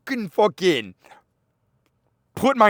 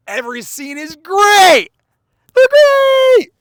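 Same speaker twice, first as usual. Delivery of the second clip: high, loud squeal of enthusiasm; gushing shout; 14 semitones higher, 15 dB louder than usual. Real, not acted. Raving that a favorite film is flawless, excited shout. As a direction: let it burst into a high-pitched yell. Raving about The Dark Knight's scenes.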